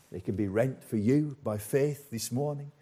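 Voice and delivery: ordinary talk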